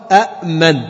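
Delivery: أأمن with both hamzas sounded, glottal stop then a second glottal stop; original double hamza. Shown as a demonstration. Teacher sounds out the old form أأمن for students. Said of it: "أصلها".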